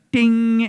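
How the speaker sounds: high, loud, ringing "ding"; microwave ding. Retold or imitated. Microwave finishing with the gremlin inside, bright ding sound. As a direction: imitate the timer bell. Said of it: "the ding joke".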